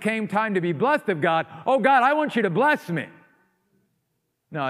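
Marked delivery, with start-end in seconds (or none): 1.7-3.1 high, mock-whiny plea to God; mock pleading. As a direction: lightly parody a selfish prayer. Mocking Esau's sudden prayer.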